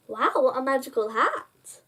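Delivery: plain reading voice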